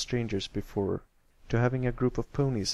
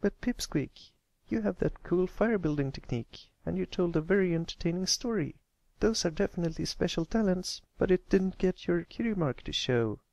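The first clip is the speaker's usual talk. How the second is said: content filly; 8 semitones higher than usual. Acted. Applebloom encourages him.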